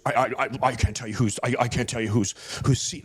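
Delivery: low, stammering sheepish dodge. Retold or imitated. Worker won't say who has the seat, sheepish. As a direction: imitate a cornered worker, stuck on first words. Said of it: "he was so sheepish".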